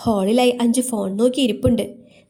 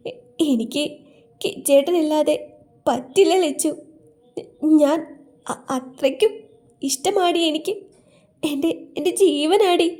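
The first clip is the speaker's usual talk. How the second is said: halting, higher-pitched, broken sobbing speech; tearful; 5 semitones higher than usual. A narrator's reading. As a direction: stammer it out through acted tears. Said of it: "Achu കരഞ്ഞുകൊണ്ട് പറഞ്ഞു". (കരഞ്ഞുകൊണ്ട്, വിതുമ്പി)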